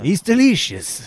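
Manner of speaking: put-on high, slow French-accented delight; playful French candlestick; Lumiere voice. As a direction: savor it like Lumiere.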